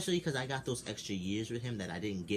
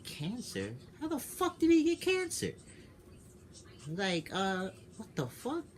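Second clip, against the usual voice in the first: shocked disbelief; 12 semitones higher, slower than usual. Retold or imitated. Telling the mom on the phone about Nelson's cancer, shocked high-pitched outburst. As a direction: retell the gut-punch of the news in a high shout. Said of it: "I was like".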